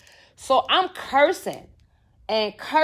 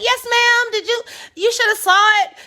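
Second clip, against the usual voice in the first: put-on high-pitched, innocent young daughter; eager child answering mama; 11 semitones higher, 4 dB louder than usual. Child answering mother in retelling.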